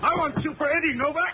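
very high, strained cry; vengeful threat. Acted. Gunman going after the man who hit Eddie, vengeful cry. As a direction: cry it out mid-fight, pitch way up. Gunman attacks to avenge partner.

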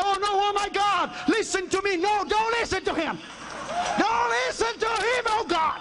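high-pitched begging cry; acted frantic plea. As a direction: implore God urgently, hammed-up.

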